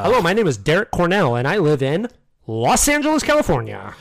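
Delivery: mock American introduction with exaggerated enunciation; overpronounced American accent.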